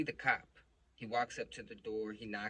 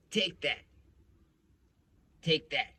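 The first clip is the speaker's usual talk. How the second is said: raised, louder imitation of a cop's order; insistent cop; 6 semitones higher, 8 dB louder than usual. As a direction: press it on him in a cop voice. Retold cop pushes money on him.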